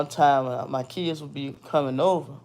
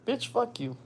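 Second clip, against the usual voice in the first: mock retort; 8 semitones higher, 6 dB quieter than usual. Voiced retort, far timbre.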